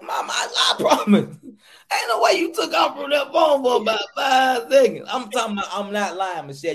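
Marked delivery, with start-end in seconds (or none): 0.0-5.1 louder, rising, laughing retold protest; mock protest. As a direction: protest loudly through a laugh. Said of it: "I'm like".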